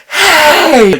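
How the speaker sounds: loud, high mock "Hey!"; mock shout. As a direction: bark it out to startle. Stalker grabbing shoulder.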